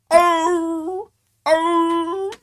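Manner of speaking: high, loud drawn-out baying arr; bay it long like a hound; hound bawl. Shown as a demonstration. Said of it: "It's a".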